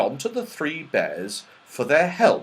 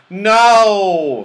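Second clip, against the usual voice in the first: firm, loud, drawn-out no, pitched up; decided; 8 semitones higher, 11 dB louder than usual. A narrator's reading. Moonface vetoes going out again to look, decided. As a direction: refuse flatly with a big emphatic no. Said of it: "said Moonface decidedly".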